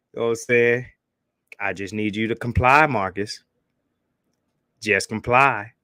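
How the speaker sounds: Officer Charles impression. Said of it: "my Officer Charles impression".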